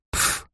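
soft dismissive "pf"; фырканье. A narrator's reading. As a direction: puff of air in a snort. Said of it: "одновременно фыркнули девушки". (пренебрежительно фыркнуть)

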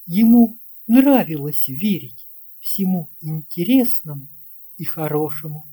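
ordinary delivery

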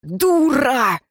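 angry growl at a sister. A narrator's reading. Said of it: "зарычал на неё старший брат".

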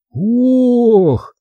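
long, high groan of revulsion; voiced disgust. A narrator's reading. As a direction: recoil with a high, drawn-out groan. Recoiling from a disgusting smell.

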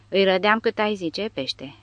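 usual speaking voice

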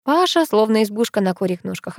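ordinary delivery, nothing marked

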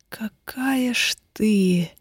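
slow, breathy whisper in her ear; hot whisper. A narrator's reading. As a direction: whisper it slowly, close to the ear. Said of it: "жаром шепнул он на ухо".

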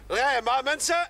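high call to the crew. Acted. Policeman calling to the road workers to drive on. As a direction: send the workers off with a shout.

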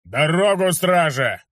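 guard captain ordering the crowd aside, loud bark. A narrator's reading. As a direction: clear the crowd with a barked order. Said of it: "громко рявкнул".

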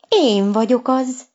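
a cat eagerly claiming he's the one; eager cry. A narrator's reading. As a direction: blurt it out proudly and keenly. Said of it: "kiáltotta lelkesen Csucsu".

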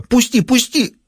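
high, strangled cry; choking panic. Acted. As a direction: gasp it out, being crushed. Winky choking under Ray's weight.

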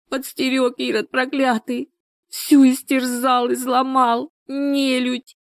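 high, drawn-out wailing lament; anguished wail. A narrator's reading. Людмила tells her friend who hurt her, wailing in anguish. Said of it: "завыла надрывно".

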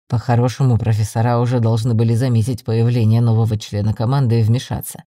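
low male character voice; deep-voiced team member with a concern; frowning blond man. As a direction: reason it out in a deep voice.

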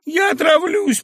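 high, loud tearful outburst; weeping cry. Acted. Young woman threatening poison, high tearful cry. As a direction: cry it out in despair. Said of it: "плакала барышня".

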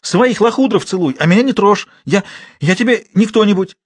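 high, loud, tearful rage; furious cry. Acted. Girl furious at man, shouting him off. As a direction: scream him away.

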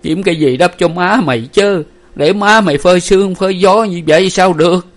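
loud, high-pitched lament; tearful. A narrator's reading. Widower asks daughter to cover his wife, tearful. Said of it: "rồi mếu máo hỏi Nguyệt".

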